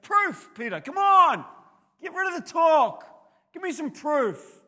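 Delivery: very high, loud demanding shout; Jesus demanding proof from Peter, forceful; challenging shout. Acted. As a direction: hammer home the demand for proof. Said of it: "Jesus challenged them".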